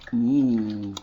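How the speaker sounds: low drawn-out munching voice; a cow with a mouthful of treats; slow, soft contented cow. Acted. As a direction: savour every word like a happy cow. Cow savouring gingerbread.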